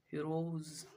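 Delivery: हीरोज with a z at the end; voiced S demo. Shown as a demonstration. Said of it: "एस का sound जो होगा".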